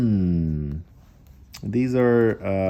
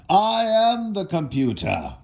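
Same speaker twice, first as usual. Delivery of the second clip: put-on old man; 9 semitones higher, slower than usual. A narrator's reading. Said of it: "said the man".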